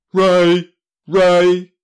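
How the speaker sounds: very loud shouted word; booming shout. Acted. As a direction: blast it out like a cannon. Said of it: "The ray gun boomed".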